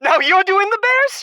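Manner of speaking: can't believe his ears, shrill with shock; high, loud indignant question; outraged disbelief. Acted. Husband outraged at host.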